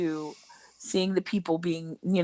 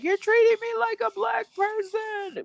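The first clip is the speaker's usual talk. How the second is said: mock-offended guy, pitched up high. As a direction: imitate a whiny entitled man. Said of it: "one guy was like".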